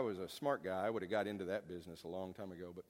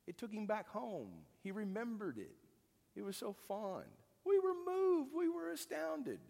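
moved recollection; 11 semitones higher than usual. Real, not acted. Moved memory of weeping carol listener.

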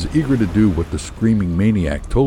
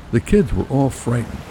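ordinary delivery, nothing marked

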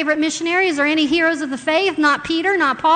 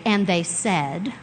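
softer and slow, downplayed; deflated quiet reading; flat, quiet telling; 8 semitones lower, 6 dB quieter, slower than usual. Shown as a demonstration. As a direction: mumble it flatly as a bare report. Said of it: "They didn't say it".

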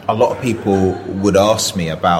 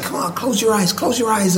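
much higher, fast, bright cartoon cowboy voice; Woody voice. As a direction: Tom Hanks doing Woody to win over a shy kid. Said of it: "he was gonna do the whole Woody accent thing".

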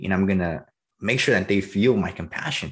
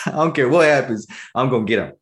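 laughing, higher and louder, fired up; excited laughing boast; 5 semitones higher, 5 dB louder than usual. Retold or imitated. Salesman boasting about closing energetic prospects, excited laugh. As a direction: laugh and boast you'll win them over.

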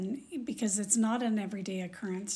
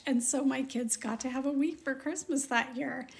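ordinary talk, nothing marked

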